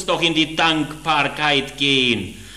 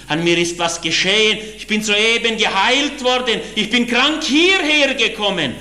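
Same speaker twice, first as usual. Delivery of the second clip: excited call; 7 semitones higher than usual. Retold or imitated. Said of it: "und rief".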